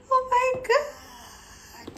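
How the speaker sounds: very high slow gasp of wonder; awed gasp. Real, not acted. Vlogger to viewers, seeing the shoes, gasping in awe. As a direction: gasp then breathe it out.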